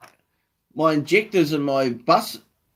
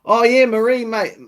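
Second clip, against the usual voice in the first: excited greeting; 8 semitones higher, 7 dB louder than usual. Real, not acted. Greeting a viewer in chat.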